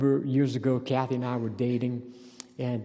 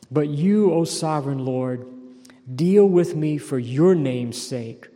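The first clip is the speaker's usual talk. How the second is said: loud, slow psalm reading; preacher reading a psalm aloud; fervent scripture reading; 3 semitones higher, 7 dB louder than usual. Acted. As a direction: proclaim the verse.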